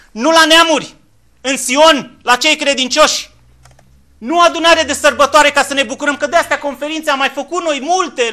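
loud, high preacher's call; fiery sermon at full volume; full-force preaching. Acted. Preaching against church conferences.

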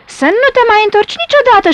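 high, fast, forceful warning; menacing wind character voice; whistling wind. A narrator's reading. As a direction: whip it out high like a gust. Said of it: "șuieră vântul".

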